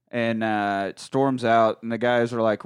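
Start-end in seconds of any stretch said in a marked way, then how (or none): none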